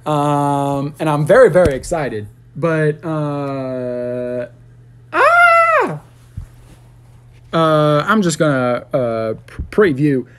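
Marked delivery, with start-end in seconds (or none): 5.1-6.0 sudden high loud shriek. Acted. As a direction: shriek out of nowhere. Sudden outburst mid-ramble.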